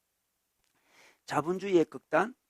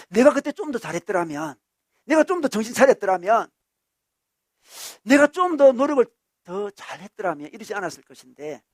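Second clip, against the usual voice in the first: self-reproach; 4 semitones higher, 8 dB louder than usual. Acted. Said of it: "자기를 비판합니다".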